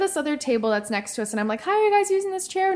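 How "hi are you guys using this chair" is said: bright, sweet asking voice, pitched up; overly polite request; polite high voice. Retold or imitated. Retelling polite chair request.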